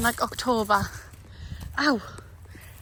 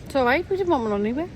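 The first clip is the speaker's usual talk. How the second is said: sarcastic; faster than usual. Retold or imitated. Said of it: "I just think".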